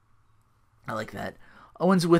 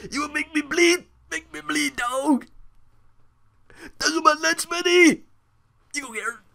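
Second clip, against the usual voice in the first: very high put-on voice; Booker T impression; 13 semitones higher than usual. Imitating the commentator's rant in a very high voice. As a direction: squeal it out in a high mock voice. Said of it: "he's like".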